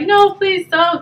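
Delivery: lightly acted plea to a principal; retold pleading. Acting out begging the principal.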